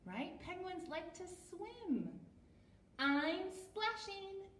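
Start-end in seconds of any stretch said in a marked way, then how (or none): 3.0-4.5 high, stretched-out character voice; Fluff's voice. A narrator's reading. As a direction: call it out high and slow. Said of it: "said Fluff".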